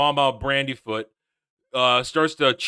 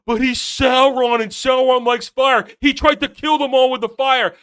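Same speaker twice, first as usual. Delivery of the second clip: louder, higher mock insistence; mocking fan theory; 7 semitones higher, 6 dB louder than usual. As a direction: mimic an overeager theorist.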